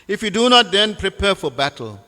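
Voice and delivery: high, loud opening, then settling; declaimed threat. Acted. Preacher reading a false king's challenge to Krishna, declamatory. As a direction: proclaim the ultimatum from a letter.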